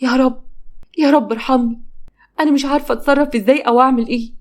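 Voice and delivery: a weary, tearful plea to God; tired and tearful. Acted. Beaten wife praying at night, tired and tearful. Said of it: "حنين بتعب وبدموع".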